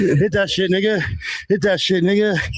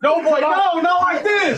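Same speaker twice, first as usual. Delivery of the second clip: high yelled no; high shout; 11 semitones higher, slower than usual. Real, not acted. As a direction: cry out in protest. Mocking shout during a scuffle.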